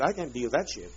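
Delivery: usual speaking voice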